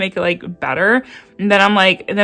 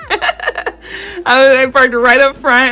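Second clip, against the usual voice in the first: higher and louder, coming out of a laugh; 6 semitones higher, 4 dB louder, slower than usual. Real, not acted. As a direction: talk on through leftover giggles. Recovers from laughing at her joke.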